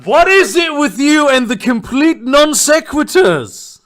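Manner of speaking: loud, high exasperated outburst; slow, exasperated disbelief. Acted. GM exasperated at random question.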